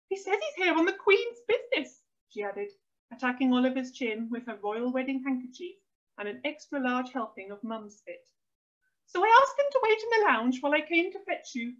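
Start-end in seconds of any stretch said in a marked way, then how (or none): 0.1-2.0 high-pitched, thrilled fussing; excited mum. A narrator's reading. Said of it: "flushed with excitement". 9.1-11.7 high-pitched, flustered explaining; excited mum. A narrator's reading. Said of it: "flushed with excitement".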